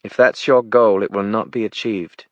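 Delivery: measured British put-down; commanding British accent. A narrator's reading. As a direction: command the room in aristocratic British English. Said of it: "said Jenna, commanding the room".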